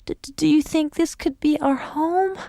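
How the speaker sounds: high, stammering cold dog; shivering. A narrator's reading. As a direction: teeth chattering in the cold.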